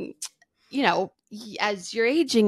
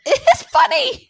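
high loud insistent reply, much higher; 7 dB louder than usual. Retold or imitated. Answering mom's rule, loud high insistence. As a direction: insist it loudly.

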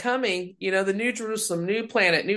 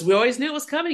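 pastor quoted; 8 semitones higher, much faster than usual. Retold or imitated. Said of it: "He goes".

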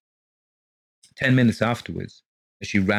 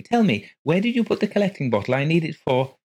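anxious wife; 9 semitones higher than usual. A narrator's reading. Wife voiced in story.

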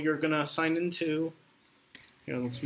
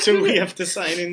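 laughing; 3 semitones higher, 7 dB louder than usual. Real, not acted. Both laughing at forgotten password.

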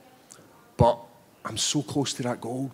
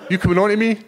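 higher and louder, playfully accusing; mock flirty teasing; 7 semitones higher, 7 dB louder than usual. Fighter jokingly accuses the sharply dressed reporter, mock flirty. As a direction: tease him like he's hitting on you.

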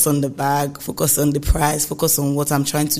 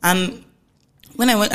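usual speaking voice

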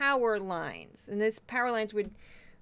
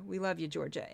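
quieter, lower repeat of "We love you, Georgia"; softer, fond aside; 5 semitones lower, 7 dB quieter than usual. Real, not acted. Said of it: "softer repeat".

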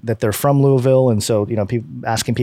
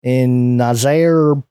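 normal talking voice

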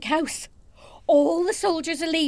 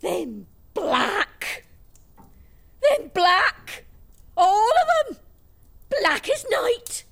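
stare in disbelief; shocked gasp; much slower than usual. Acted. Woman shocked by soldiers.

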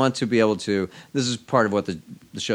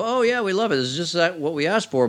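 high-pitched, eager retold praise; enthusiastic approval; 10 semitones higher, 4 dB louder than usual. Network people retelling their love of the pilot, enthusiastic approval. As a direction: gush lightly, pitched well up.

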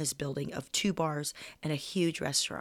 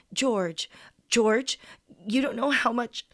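choked-up thanks, pitch climbing; a voice cracking with gratitude; through choked tears; 5 semitones higher, 3 dB louder, slower than usual. Retold or imitated. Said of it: "I said through choked tears".

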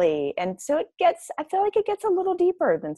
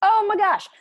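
excited squeal; 14 semitones higher, 5 dB louder than usual. Real, not acted. Reacting to book.

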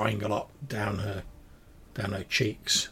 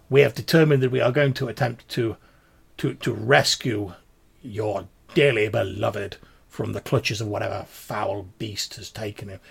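theatrical, formal gentleman's vow; pompous gallant gentleman; 6 dB louder than usual. Acted. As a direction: declare the rescue with halting grandeur.

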